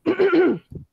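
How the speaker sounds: loud throat clearing; preparatory throat clear. Real, not acted. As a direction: clear the throat before performing.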